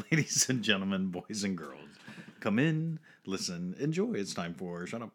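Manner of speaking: loud show-host intro; announcer. Acted. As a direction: welcome the crowd like an emcee.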